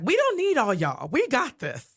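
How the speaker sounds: high, sassy dismissal; defiant. Retold or imitated. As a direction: brush them off with attitude. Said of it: "And she's like".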